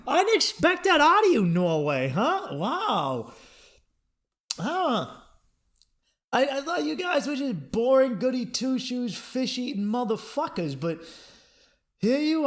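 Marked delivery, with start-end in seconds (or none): none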